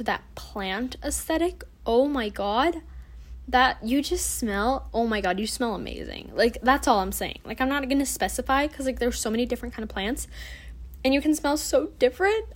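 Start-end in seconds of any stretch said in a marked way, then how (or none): none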